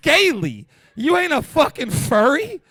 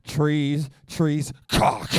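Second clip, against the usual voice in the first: slow, low listing; 9 semitones lower, much slower than usual. Retold or imitated. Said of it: "We're like".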